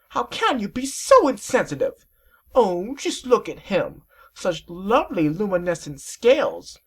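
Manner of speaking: very high, slow refined lady voice; drawn-out fashionable lady; high, affected voice; prissy Rarity. Acted. Rarity scolds then coos.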